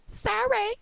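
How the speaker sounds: very high, drawn-out silly squeal; mock squeaky apology. Player gloating over a comeback win, mock squeaky apology. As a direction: squeak out a joke apology in falsetto.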